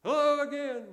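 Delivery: loud, high call across a canyon; shouted greeting. Acted. Preacher testing the Grand Canyon echo, shouted. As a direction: holler it far out. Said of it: "so we shout".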